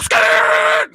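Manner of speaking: loud screeching car noise; imitated tire screech. Car speeding off after the drop-off, loud imitated screech. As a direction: screech like tires peeling away.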